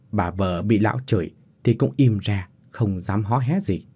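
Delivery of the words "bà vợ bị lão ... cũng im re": ordinary delivery, nothing marked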